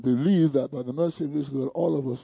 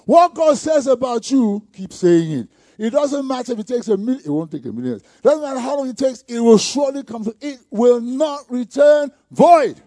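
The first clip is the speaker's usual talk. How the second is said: preach; 6 semitones higher, 8 dB louder than usual. Acted. Preaching at full force.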